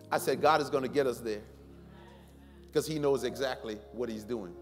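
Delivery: ordinary talk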